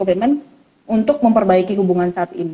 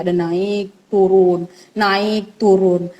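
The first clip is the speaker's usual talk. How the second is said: slow; 3 semitones lower, much slower than usual. Real, not acted. Repeats naik turun for emphasis.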